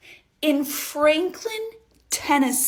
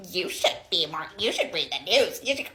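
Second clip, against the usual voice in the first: lower, soft, preachy scolding; lecturing woman; 7 semitones lower, 4 dB quieter than usual. Retold or imitated. The older woman lectures the narrator, low and preachy. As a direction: lecture her like a know-it-all.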